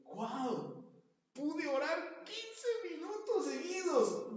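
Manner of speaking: amazed delight. Retold or imitated. Preacher playing a believer amazed at his prayer, high and slow. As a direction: marvel at your own prayer.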